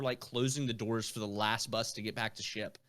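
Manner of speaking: normal talking voice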